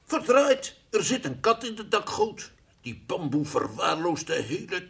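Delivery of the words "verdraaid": loud, high startled curse; startled exclamation. Acted. A bird startled by a cat, loud high curse. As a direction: jump at the sound.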